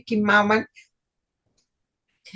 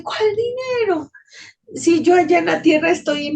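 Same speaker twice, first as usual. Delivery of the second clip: much higher, startled question; shocked outcry; 6 semitones higher than usual. Retold or imitated. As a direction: cry out in disbelief. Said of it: "Y yo grité". (pregunta incrédula y aguda)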